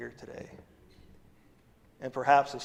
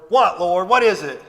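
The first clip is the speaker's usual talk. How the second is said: mock impatient reply; 3 semitones higher, 8 dB louder than usual. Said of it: "When it's time for you".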